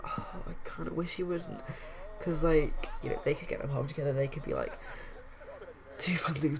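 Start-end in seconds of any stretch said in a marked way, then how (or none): 0.0-1.6 wistful. Acted. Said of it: "Penny's feeling a little bit down".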